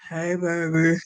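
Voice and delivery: low, hammed-up teen boy greeting; mock deep boyfriend voice. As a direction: drop low like a boy trying to sound grown. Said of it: "definitely was not, um, a deep voice".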